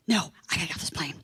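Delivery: fast, breath-only whisper; whispered refusal. Retold or imitated. Passenger refuses to sit back down, fast whisper. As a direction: whisper it urgently, mock desperate.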